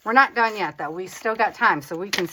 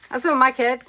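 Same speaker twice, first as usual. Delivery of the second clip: ordinary delivery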